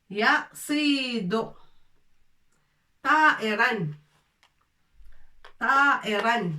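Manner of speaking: Yasido stretched out slowly, syllable by syllable; slow drill. Shown as a demonstration. Arabic teacher drills a word to the class, slowly. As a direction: draw out Yasido for learners. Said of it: "teacher drills the word".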